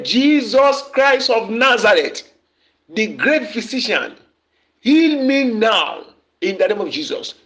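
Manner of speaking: shouted prayer. Shown as a demonstration. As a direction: cry it out to heaven. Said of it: "Shout this prayer wo-, very loud".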